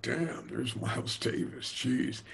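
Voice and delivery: hushed whisper on seeing a hero; awed whisper. Retold or imitated. Young drummer, seeing [name] on the couch, whispers in awe. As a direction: breathe it out in stunned awe. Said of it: "And I'm like".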